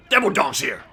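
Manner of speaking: lightly voiced gung-ho Marine; a hint of the Marine voice actor; mock Marine voice. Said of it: "Devil dog, he really said it".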